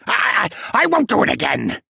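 high stammering panicked plea; begging. A narrator's reading. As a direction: plead desperately. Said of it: "the penguin begged".

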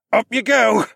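high, loud straining call; effortful grunt through the words. Acted. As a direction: say it while heaving someone upward. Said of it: "Horace grunted as he pulled".

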